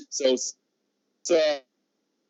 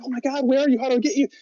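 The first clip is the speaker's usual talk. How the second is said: put-on frantic girlfriend; 9 semitones higher, faster than usual. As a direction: mimic her alarm, pitched up. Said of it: "she's, like, losing her mind".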